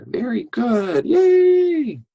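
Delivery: high, loud happy cheer. Real, not acted. Teacher cheering kids for saying words right, high and loud. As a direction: celebrate them out loud.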